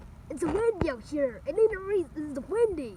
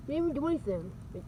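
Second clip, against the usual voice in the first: normal talking voice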